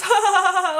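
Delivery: put-on high laugh; imitated cute laugh. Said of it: "like, this cute laugh".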